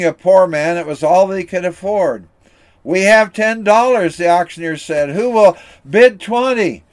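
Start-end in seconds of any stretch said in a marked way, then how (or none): 2.8-4.2 higher, louder calling out the bid; auctioneer's call. Acted. Auctioneer announcing the gardener's bid, calling out loud. 5.1-6.8 higher, louder calling out the bid; auctioneer's call. Acted. Auctioneer announcing the gardener's bid, calling out loud.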